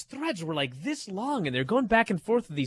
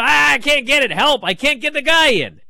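loud, high mock whine of frustration; grown father whining; 9 semitones higher, 9 dB louder than usual. Host imagining his father faking helplessness with the toy car, loud. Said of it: "he started going".

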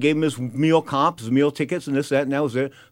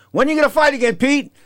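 high, louder teasing question; 10 semitones higher, 3 dB louder than usual. Retold or imitated. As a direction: needle him about fighting. Maitre d' asks drunk boxer.

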